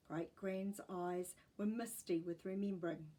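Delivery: usual speaking voice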